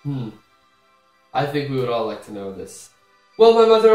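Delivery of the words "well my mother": higher and louder proclamation; grand address. Acted. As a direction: announce it with pomp.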